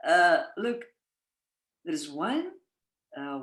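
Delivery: normal talking voice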